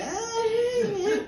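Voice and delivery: squeezed-out high "Ah, yeah" groan; high strained dad groan. Retold or imitated. Dad groaning at the movie choice, high and strained. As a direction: let a high pained groan slip out. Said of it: "he was like".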